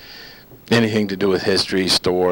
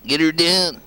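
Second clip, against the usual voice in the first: high country-comic catchphrase voice; put-on folksy twang, pitched up; Larry the Cable Guy; 8 semitones higher, slower than usual. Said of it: "The Larry the Cable Guy saying".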